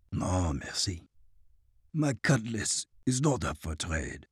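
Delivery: put-on low, soft French-accented no; quiet firm French refusal. A narrator's reading. Francois turns down the flamethrower, quiet French accent. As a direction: decline firmly but quietly.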